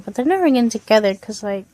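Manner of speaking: ordinary delivery, nothing marked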